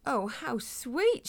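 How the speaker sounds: high, slow cooing; gushing coo. A narrator's reading. Lady peers at the little hedgehog, cooing. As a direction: draw it out adoringly.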